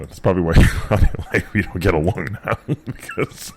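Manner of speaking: talking through laughter; laughing. Real, not acted. Admitting to teasing his sister as a kid, laughing. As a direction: chuckle while saying it.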